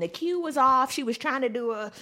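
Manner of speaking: ordinary talk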